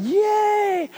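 high, bright cheer; team cheer. Retold or imitated. The whole team cheers for the boy, high. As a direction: burst out with a kids' cheer.

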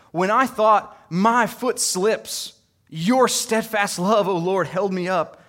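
loud, high, proclaiming the psalm; fervent scripture reading. Acted. Pastor proclaiming Psalm ninety-four to the church, fervent reading. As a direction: declaim the verses like a testimony.

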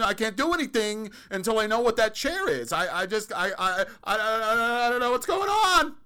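rising into a high, loud, frantic wail; panicking. Acted. Person staring at a chair for four days, panicking in a climbing high voice. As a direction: lose it completely over a simple chair.

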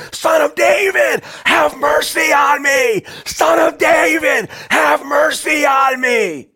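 slow, high, full-voiced shout; loud, stretched cry for mercy. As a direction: bellow each phrase as a drawn-out plea. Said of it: "He shouts louder".

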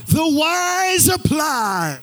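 high, drawn-out full shout; like you mean it. Shown as a demonstration. Preacher getting the congregation to repeat the point, shouted like you mean it. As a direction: belt it out to rouse a crowd, stretching each word. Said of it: "say it like you mean it".

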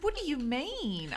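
high, loud, drawn-out question; retold astonishment, stretched out; curious. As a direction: re-enact a baffled, curious demand. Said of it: "I was so curious".

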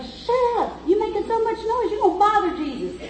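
high-pitched mock scolding; crowd hushing him. Crowd tells noisy blind man to hush, scolding. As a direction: shush him as an annoyed bystander. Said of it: "everybody was telling him to hush before".

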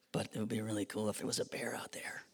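fast excited whisper. Retold or imitated. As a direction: whisper like you fear bears hear you. Camper whispering in tent.

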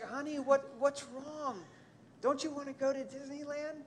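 a hint of worried mom asking her son; confused parents. Parents ask their sobbing son what's wrong, mild concerned voice. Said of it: "They're like".

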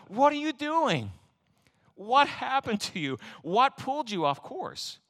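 high, slow, incredulous questioning; exasperated apostle scolding his flock; emphatic Paul. Retold or imitated. Paul demanding of the Galatians what went wrong, slow and high. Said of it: "Paul was so emphatic".

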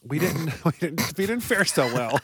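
ordinary talk, nothing marked

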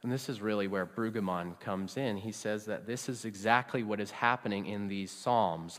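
usual speaking voice

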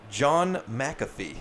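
ordinary talk

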